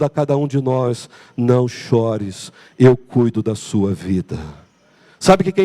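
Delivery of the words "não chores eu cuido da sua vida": slow and gentle, barely above a hush. Acted. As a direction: comfort a grieving mother. Jesus voiced softly, low. (voz baixa e terna)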